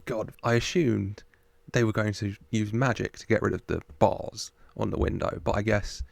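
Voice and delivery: ordinary talk, nothing marked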